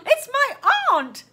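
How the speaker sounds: put-on high, loud girl's outburst; exclaiming. A narrator's reading. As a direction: blurt it too loud, slowly. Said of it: "exclaimed Stella, a little louder than she should have said".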